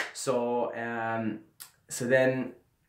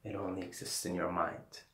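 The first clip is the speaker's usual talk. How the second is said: mumbled guru wisdom; 3 semitones lower, 6 dB quieter than usual. Retold or imitated. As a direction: mumble it like a stoned sage. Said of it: "say it in the way that I was".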